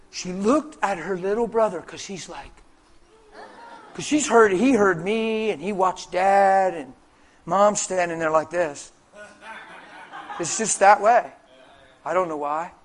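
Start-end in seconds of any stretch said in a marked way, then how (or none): none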